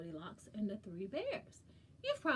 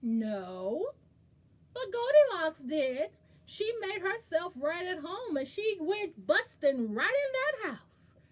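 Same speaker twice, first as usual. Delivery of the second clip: louder, higher telling-off; emphatic scolding; 7 semitones higher, 10 dB louder than usual. Acted. Storyteller warning children about Goldilocks, loud. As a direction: make the naughtiness big.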